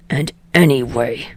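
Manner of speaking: snarled. Acted. Bakugo answering Deku's call, low snarl. Bakugo continues over Deku.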